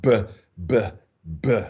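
"B" as a short plosive "buh", each alone; isolated, percussive "B" bursts like heartbeats; spat-out B. Shown as a demonstration. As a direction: spit out each "B" with gaps between. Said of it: "the spat out B".

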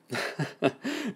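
short, soft, high chuckle; soft laugh. Acted. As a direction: laugh quietly under the breath. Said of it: "Hewitt laughed".

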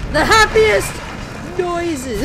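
high, loud delivery; loud exclamation. Real, not acted. Reacting to noises.

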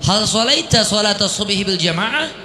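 "Hal sholaita sholata subhi bil jamaah" high throughout; questioning high tone. Shown as a demonstration. Teacher models asking about congregational prayer, high questioning intonation.

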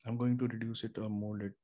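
ordinary talk, nothing marked

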